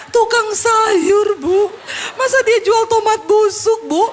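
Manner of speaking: high, loud, hammed-up wail; mock tearful complaint. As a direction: parody sobbing over a petty grievance.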